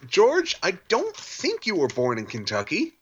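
high, loud mock scolding. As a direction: yell at George in mock outrage. Mocking George Lucas loudly.

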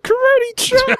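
very high falsetto karate cry; Miss Piggy voice. Pretend. Joining the Muppet bit with a very high piggy cry.

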